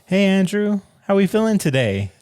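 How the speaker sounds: high, sweet therapist voice. Pretend. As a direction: gently coax a young patient. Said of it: "act out a possible therapy session".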